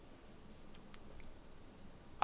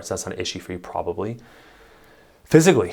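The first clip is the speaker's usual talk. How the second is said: normal talking voice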